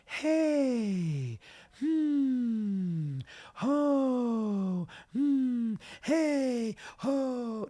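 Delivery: high, light falsetto calls. Shown as a demonstration. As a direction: float each syllable up high and airy. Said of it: "So you wanna start off by saying".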